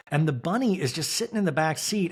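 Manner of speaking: ordinary talk, nothing marked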